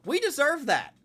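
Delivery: high, loud exasperated repeat; exasperated cry. Real, not acted. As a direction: throw it up to the ceiling in frustration. Repeats much higher.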